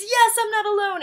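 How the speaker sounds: high, loud joyful cry; relieved cheer. Retold or imitated. Explaining her cheer at her friend crying, loud and relieved. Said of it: "What I meant is".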